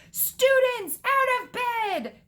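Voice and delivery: high, loud, drawn-out alarm call; bellowing. A narrator's reading. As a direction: bellow it high to rouse the whole castle. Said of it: "Peeves bellowed".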